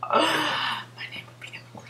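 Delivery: breathless whisper after a gasp; gasp, then whisper it in awe. Real, not acted. Repeats line, breathless gasp.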